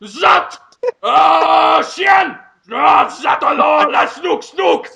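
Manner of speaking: put-on high, strained French-accented yelling; hammed-up French screaming; screaming mock French. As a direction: shout nonsense French in absurd bursts. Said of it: "Just screaming at the top of his lungs".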